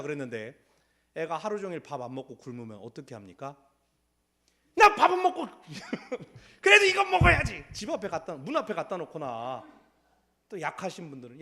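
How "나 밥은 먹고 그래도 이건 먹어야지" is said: high, loud laughing parent voice; laughing fussing parent. Acted. (웃으며 높고 크게 안달하는 부모)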